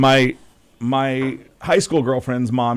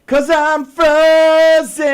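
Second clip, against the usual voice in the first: mock frozen; 5 dB louder, much slower than usual. Joke about frozen video.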